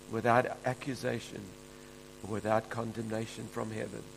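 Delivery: soft, hushed prayer voice. Real, not acted. Pastor praying over the congregation in a soft, hushed voice. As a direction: pray it quietly and reverently.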